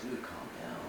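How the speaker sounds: soft, held-back aside, as if thinking it; inner voice. Retold or imitated. Crew member silently reacting to a boastful coworker, quiet inner voice. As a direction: say it under your voice, as a private thought. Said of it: "I'm just in my head".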